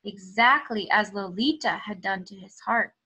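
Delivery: lively, expressive storybook reading; reading to children. Acted. Teacher reading a story aloud to her students, expressive storytelling. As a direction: tell it like a picture book for the class. Reads picture book aloud.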